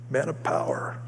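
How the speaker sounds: whispered, self-assured aside; hushed boast. Acted. Preacher playing his confident past self, hushed boast. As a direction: half-whisper it like a secret title.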